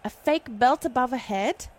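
put-on proper British commentator; very proper British accent. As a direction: narrate it like a proper Brit. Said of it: "yours is, like, a very proper British".

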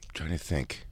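whispered aside to herself; whispering. Real, not acted. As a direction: murmur it while thinking. Thinking aloud mid order.

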